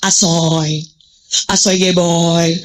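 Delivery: lightly voiced retro slang, slow; old slang. Mother recalling her youth slang, slowly mimicked. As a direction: drawl asoy geboy like a lightly mimicked old-school teen. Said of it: "Zaman dulu kan gitu".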